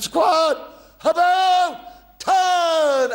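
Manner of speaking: high, loud order across the parade ground; shouted drill command. Retold or imitated. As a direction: bellow it to a far-off squad. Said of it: "And so I said".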